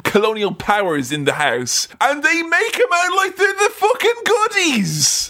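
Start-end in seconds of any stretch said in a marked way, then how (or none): 2.0-5.3 high-pitched indignant rant; comic outrage, voice shooting up; indignant outburst. Real, not acted. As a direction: pitch soars in outraged disbelief. Indignant rant about colonial portrayal.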